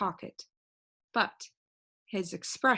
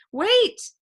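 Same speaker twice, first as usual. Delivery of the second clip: high, loud call after someone; loud, high shout of Wait; 13 semitones higher, 10 dB louder than usual. A narrator's reading. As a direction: yell for him to stop. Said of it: "shouted Milo".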